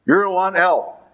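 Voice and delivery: ordinary talk, nothing marked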